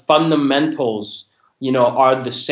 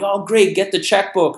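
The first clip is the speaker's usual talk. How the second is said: higher, eager salesman ready to close; mock excited; 6 semitones higher than usual. Salesman in a mother's home, hamming up his excitement. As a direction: play it like the sale is already made. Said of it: "getting excited and going".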